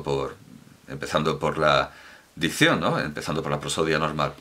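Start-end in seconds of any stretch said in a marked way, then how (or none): none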